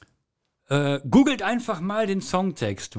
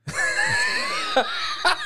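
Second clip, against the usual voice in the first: stifled giggles. Real, not acted. Nervous before singing.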